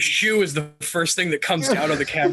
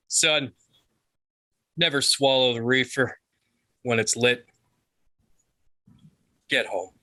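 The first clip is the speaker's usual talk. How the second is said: lightly voiced dad, dropping lower at the end; fatherly advice; 3 semitones lower than usual. Alvin to Davey before he leaves, lightly voiced fatherly advice.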